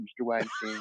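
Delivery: usual speaking voice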